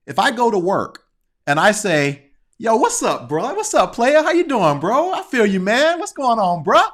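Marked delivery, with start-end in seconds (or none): 2.6-6.8 casual street slang. Retold or imitated. Guy acting out work talk that passes at Uncle Jesse's barbecue, loose and slangy. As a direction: greet coworkers like buddies at a backyard barbecue. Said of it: "if I go to work talking like that".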